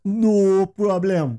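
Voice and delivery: very slow, high mock Jamaican accent. Shown as a demonstration. Capping the accent joke, hammed-up Jamaican accent. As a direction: stretch it out in a put-on Jamaican accent. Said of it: "like you're Jamaican".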